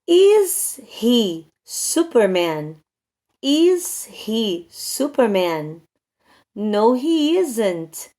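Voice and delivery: very slow, carefully spaced English examples; drawn-out classroom dictation pace; language model sentences. Shown as a demonstration. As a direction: pronounce each word deliberately for learners to copy.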